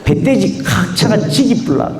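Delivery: rough Gyeongsang dialect, laughing through it. Retold or imitated. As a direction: toss off a crude Gyeongsang line with a laugh. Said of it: "이런 말".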